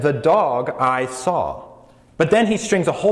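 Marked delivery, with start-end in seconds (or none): 0.0-1.6 slow, deliberate reordered example; a teacher's slow demo of the object-first sentence; backwards word order. Shown as a demonstration. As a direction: lay out the flipped sentence slowly. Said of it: "he says, and so with our example".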